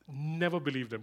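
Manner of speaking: ordinary delivery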